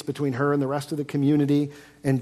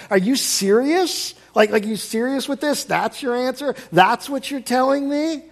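higher, louder, slow incredulous questions; skeptical questioning; 9 semitones higher, 4 dB louder than usual. Acted. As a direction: challenge them like it's a joke. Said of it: "skeptical, questioning tone".

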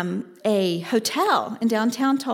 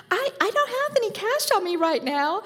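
much higher flustered stammer; flustered woman; 12 semitones higher than usual. Retold or imitated. Woman refusing to lend money, much higher flustered voice. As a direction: stammer an excuse, caught off guard.

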